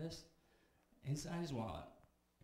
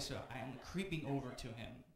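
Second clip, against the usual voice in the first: ordinary talk, nothing marked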